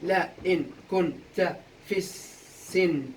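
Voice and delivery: very slow, each syllable on its own; syllable-by-syllable scansion. Shown as a demonstration. Teacher going back over the verse for students, syllable by syllable. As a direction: break the words into metrical beats. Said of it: "هسا أول سؤال كالعادة قطع".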